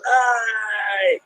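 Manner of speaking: sharp high cry of pain; imitating a cry of pain. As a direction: yelp out like a sore hand at dawn. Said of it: "So he goes".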